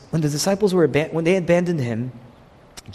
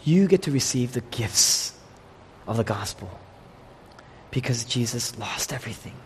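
ordinary talk

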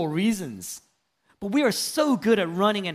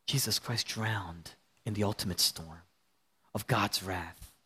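solemn hush; 11 semitones lower, 7 dB quieter than usual. Real, not acted. Solemn low climax.

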